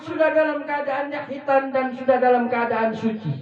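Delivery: high, sustained sermon proclamation; full-voiced preaching. Preacher announcing the Prophet's birth to the congregation, exultant preaching.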